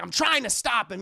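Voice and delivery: loud, high, exasperated protest; acted indignant outburst; protesting shout. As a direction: shout it defensively. Arthur protests to the Joker.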